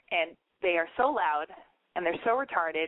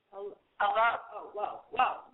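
through a bullhorn; much slower than usual. Real, not acted. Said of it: "here's what I sound like on the bullhorn".

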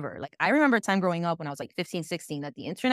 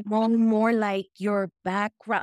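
slow, higher, drawn-out hinting; awkward fishing; 4 semitones higher, 5 dB louder, much slower than usual. Retold or imitated. Questioner fishing for someone's background, awkwardly hinting. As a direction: imitate someone groping for polite words.

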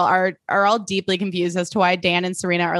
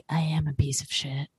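mock dejected; 5 semitones lower, 7 dB quieter than usual. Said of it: "Chuck's face sort of fell".

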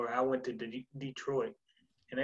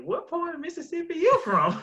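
much higher, squeaky mock voice of a puzzled teammate; mock teammate question; 4 dB louder than usual. Athlete imitating teammates asking where she is from, high mock voice. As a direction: pitch it way up like a baffled teammate. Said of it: "and they're like".

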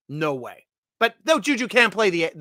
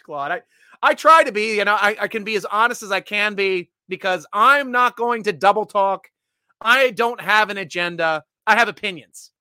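ordinary delivery

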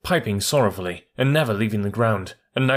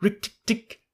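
much higher gleeful chuckle; chuckling; 12 semitones higher, slower than usual. A narrator's reading. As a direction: crow with glee. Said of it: "chuckled Rikki Tikki".

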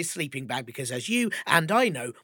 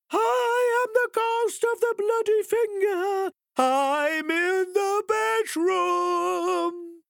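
extremely high slow wailing ghost; 4 dB louder, much slower than usual. Acted. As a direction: wail it at full terror. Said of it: "the voice sounded so close".

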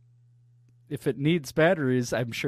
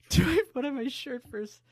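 incredulous; 13 semitones higher, 4 dB quieter than usual. Real, not acted. Incredulous echo question.

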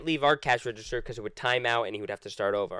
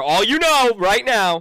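announcer tease; 7 semitones higher, 9 dB louder, slower than usual. Pretend. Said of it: "After these quick messages".

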